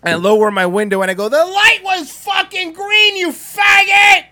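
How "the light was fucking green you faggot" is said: high, full-blast furious shout; top-of-lungs yell. Retold or imitated. Driver yelling out the window at a jogger, top-of-lungs shout. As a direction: scream it out slowly at full volume. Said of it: "At the top of his lungs".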